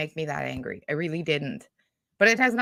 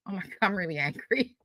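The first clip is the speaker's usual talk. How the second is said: ordinary talk, nothing marked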